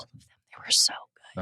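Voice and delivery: whispering. Real, not acted. Gushing about beloved book series.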